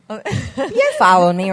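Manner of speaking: laughing, slow and loud; śmiejąc się pyta, czy nadąża. Real, not acted.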